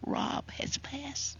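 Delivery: a whispered, softened telling of a death; very softly. Retold or imitated. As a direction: breathe the bad news gently. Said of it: "very softly".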